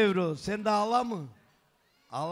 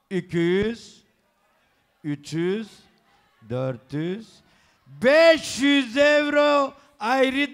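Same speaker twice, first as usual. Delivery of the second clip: booming wedding announcer; 2 semitones higher, 5 dB louder than usual. Acted. Counting up gift money aloud.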